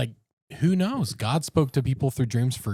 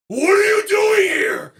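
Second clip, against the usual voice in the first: high, loud, slow menacing yell; sleepwalker's scream; 8 dB louder, slower than usual. Retold or imitated. Sleepwalking roommate looms over him, slow high scream.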